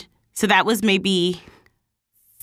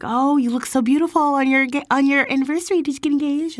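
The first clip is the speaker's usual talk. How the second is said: imitating nosy friends; 9 semitones higher, faster than usual. People asking about anniversary.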